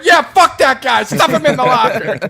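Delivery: loud, high jeering bully; mock bully voice. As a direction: egg on the fight like a schoolyard bully. Mocking a bully egging on.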